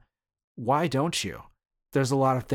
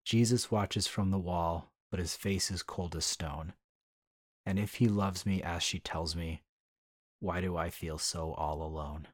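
sad poem reading; 5 semitones lower, 6 dB quieter than usual. Acted. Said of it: "rather sad and beautiful".

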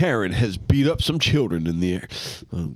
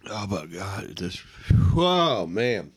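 mouth burning; 7 semitones lower, slower than usual. Real, not acted. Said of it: "Everything's burning".

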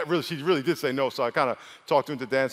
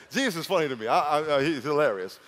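ordinary talk

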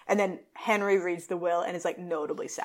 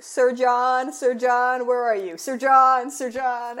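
calling Sir John loudly into the house, half-acted; louder retold calls for the host; barging in and calling loudly for the host; mock yelling; 5 semitones higher, 4 dB louder, much slower than usual. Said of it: "just yelling".

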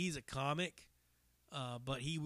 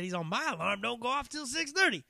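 bragging guy; 10 semitones higher, 4 dB louder than usual. Retold or imitated. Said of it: "And he said".

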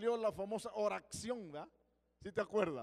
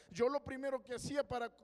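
ordinary talk, nothing marked

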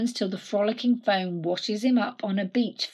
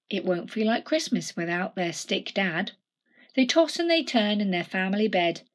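usual speaking voice

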